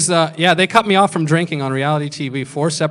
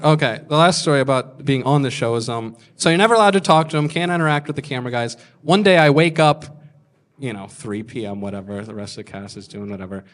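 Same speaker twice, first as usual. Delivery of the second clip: ordinary talk, nothing marked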